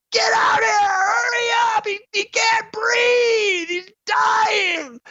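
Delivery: high squealing mock scream for help. Players screaming at the medics, high squealing scream. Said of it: "players, like, screaming".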